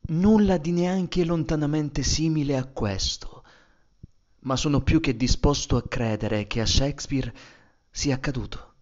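usual speaking voice

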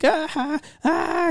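high-pitched mock laughing cries; imitated cracking up. Employee heard cracking up from the back, imitated laughter. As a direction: imitate a coworker losing it laughing. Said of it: "I just hear Ruben cracking up like".

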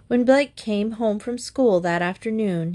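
usual speaking voice

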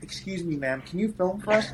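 politely asking a favor; polite request. Retold or imitated. Politely asking an old lady.

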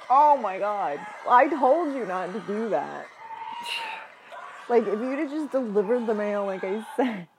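loud exasperated telling-off; exasperated scolding. Real, not acted. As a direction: scold loudly in disbelief. Scolding character loudly.

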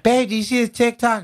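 high, sing-out partner voice; high-pitched mock "babe" voice; partner impression. Acts out partner asking him.

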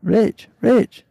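high, loud Yorkshire wake-up call; Yorkshire accent attempt. A narrator's reading. Shipmate waking sleeper with Yorkshire accent.

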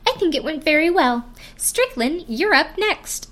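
a hint of breezy friendliness, slowing on the last words; amicable tone. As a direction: sound pleasant as if all went well. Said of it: "in a very amicable, amicable tone".

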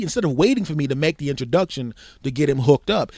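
usual speaking voice

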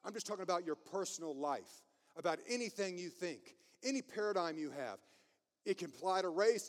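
ordinary talk

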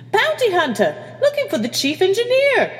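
very high, loud squawk. Acted. Communications officer warns the captain of a bounty hunter, squawking. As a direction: squawk it out in alarm. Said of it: "Creevy squawked".

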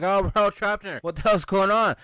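very loud, high panicked cry; freaking out. Retold or imitated. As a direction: scream it in terror. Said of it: "everyone starts freaking out".

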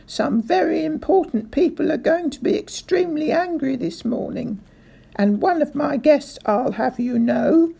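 high, loud threatening rant; angry threat. A narrator's reading. Furious threat to the sergeant.

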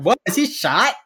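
put-on high, loud startled kid; kid's alarmed question. Kid asks about the actor being shot, high and loud. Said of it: "Some twelve-year-old boy is like".

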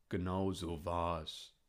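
slow old badger voice. A narrator's reading. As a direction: confirm it slowly as an old badger. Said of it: "rief der alte Dachs". (langsamer alter Dachs)

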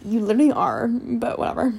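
ordinary delivery, nothing marked

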